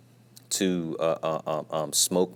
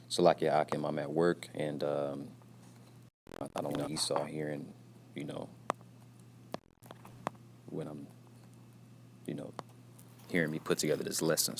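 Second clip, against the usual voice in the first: hushed, softer voice so coworkers won't overhear; talking low; 3 semitones lower, 9 dB quieter than usual. Real, not acted. At work, keeping the lesson from being overheard, speaking low. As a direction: keep it down, speaking under your breath at work. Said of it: "talking a little low".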